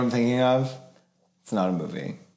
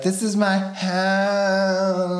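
MTV Cribs host; 9 semitones higher, much slower than usual. Retold or imitated. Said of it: "get MTV Cribs in there. And be like".

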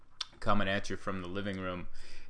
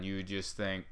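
ordinary delivery

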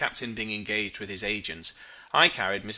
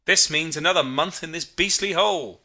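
louder, higher irritated complaint; exasperated grumble; 5 semitones higher, 6 dB louder than usual. A narrator's reading. Mate complaining to narrator after the collision, exasperated and raised. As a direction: complain hotly to a shipmate.

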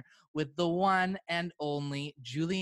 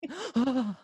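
high, hammed-up ghostly gasp; spooky gasp; 10 semitones higher than usual.

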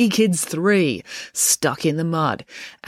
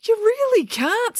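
very high, drawn-out protest; anxious objection; slower than usual. A narrator's reading. Joe telling April she cannot cheat, high protest.

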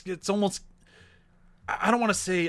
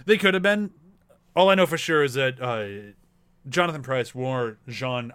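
ordinary delivery

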